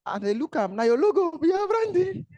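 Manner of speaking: high, huffy protest about the logo; mock offended businessman; indignant client. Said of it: "He say".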